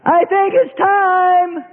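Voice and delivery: high, slow whiny voice; slow high whining plea; impatient believer. Acted. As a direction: drag it out pleadingly.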